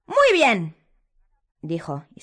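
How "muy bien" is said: high, loud simpering schoolmistress; a sugary menace pitched high; Umbridge voice. A narrator's reading. Umbridge snapping at Harry before drawing her wand.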